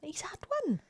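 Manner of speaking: hushed whispered reply. Real, not acted. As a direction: whisper it so the dog won't hear. Quiet reply during recording.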